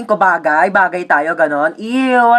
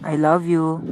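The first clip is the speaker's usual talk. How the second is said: low, soft confession; tender love line; 7 semitones lower, 6 dB quieter, slower than usual. Acted. Actor to co-star in romantic scene, tender. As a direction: say it softly and low, lovingly.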